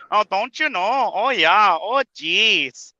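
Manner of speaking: playful mock Minnesotan accent; Minnesota accent. Echoing the coworker impression, Minnesota accent. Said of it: "her accent would come out strong".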